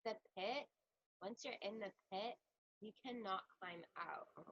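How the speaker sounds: very soft, low and gloomy; dark quiet voice. Acted. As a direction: sink into a hushed, doom-laden murmur. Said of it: "You are so quiet and dark".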